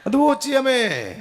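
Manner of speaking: usual speaking voice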